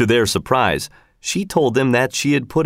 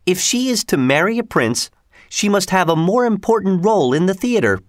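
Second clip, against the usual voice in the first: higher woman's voice, earnestly confiding; Missus Giry; 6 semitones higher than usual. A narrator's reading. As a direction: play the believer explaining herself, pitched up.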